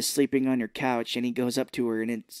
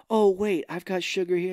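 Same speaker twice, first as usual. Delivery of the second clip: higher, hurried-in stopping voice; lightly acted "hold on", pitched up; mock sudden interruption; 5 semitones higher than usual. Retelling him stalling the cops, mock interruption.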